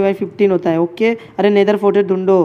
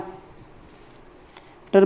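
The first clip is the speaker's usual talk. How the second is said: drawn out; 7 dB quieter, much slower than usual. Real, not acted. Drawn-out instruction to teammate.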